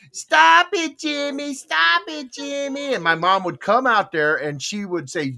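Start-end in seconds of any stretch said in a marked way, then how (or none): 0.1-2.9 high-pitched mock little-kid yell; imitated sister yelling. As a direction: play a little sister crying out on her brother. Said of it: "she'd just start yelling".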